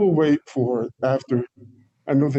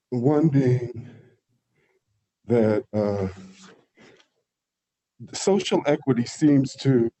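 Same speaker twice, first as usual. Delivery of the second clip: out of breath; slower than usual. Real, not acted. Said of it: "I'm actually out of breath 'cause I kinda ran up here".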